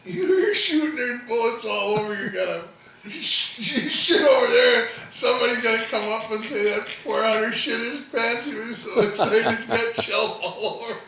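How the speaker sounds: high, loud words through real laughter; can barely get it out for laughing; laughing hard. Retold or imitated. Teasing his hunting buddy, laughing loud and high. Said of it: "I'm laughing so freaking hard".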